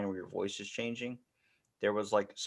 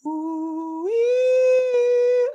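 high, loud whistling mouth wind; wind sound effect; 12 dB louder than usual. Shown as a demonstration. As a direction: blow a long howling wind with your voice. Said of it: "sound effect wind where it's like".

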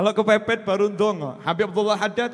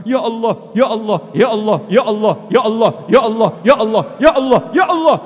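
repeated cry to God, building louder; insistent supplication; 6 dB louder than usual. Acted. Servant begging God without stopping, building insistent cry. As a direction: call out over and over, rising in force.

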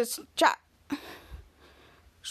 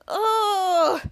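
long, raised mock groan; put-on groan of stomach pain; acted pained groan; 4 semitones higher than usual. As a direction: moan as if your belly hurts. Charlotte groaning with stomach ache.

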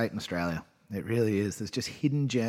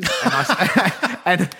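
laughing; 5 semitones higher, 7 dB louder, much slower than usual. Real, not acted. Laughing at school memory.

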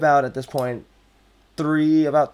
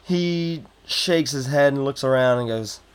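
ordinary delivery

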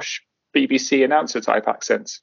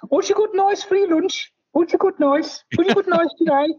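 imitating a hospital colleague. Mimicking a hospital coworker asking about his lunch, high-pitched. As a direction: squeak it out like the nosy lady asking about lunch. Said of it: "there was one lady who says".

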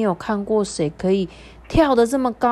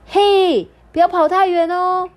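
high, loud motherly call; calling out loudly; 11 semitones higher, 5 dB louder than usual. A narrator's reading. Kangaroo mother calling after her little one, loud and high. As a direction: call after a child hopping away. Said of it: "她大声地说".

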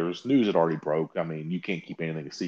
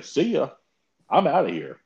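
breezy brush-off; 7 semitones higher, 4 dB louder than usual. Retold or imitated. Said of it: "they'll just say".

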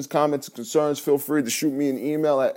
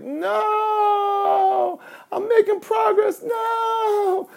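high yelled no, I'm making progress; desperate protest; much slower than usual. Retold or imitated. Dreamer yelling at his alarm in mock despair. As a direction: cry out against waking, pitched way up.

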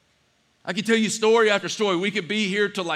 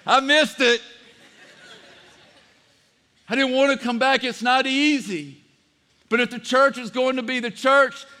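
higher and driving, preaching to the congregation; full-force preaching; 5 semitones higher than usual. Acted. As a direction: lift it up and preach.

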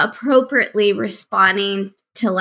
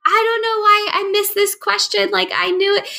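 much higher, pleading voice of a fretting student; anxious perfectionist student; 11 semitones higher than usual. Retold or imitated. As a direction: whine to the professor about one missed question. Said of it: "going to my professor and be like".

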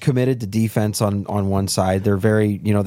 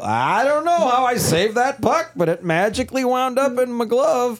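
confused goalie impression; 11 semitones higher than usual. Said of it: "you just kinda go".

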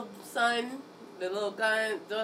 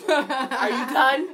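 sassy retort; 4 semitones higher, 9 dB louder, much slower than usual. Pretend. Sassing the girlfriend.

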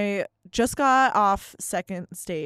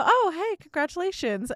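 cheerful congratulations; 9 semitones higher than usual. Retold or imitated. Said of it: "So I go".

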